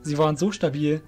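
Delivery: ordinary delivery, nothing marked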